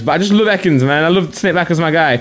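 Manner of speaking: normal talking voice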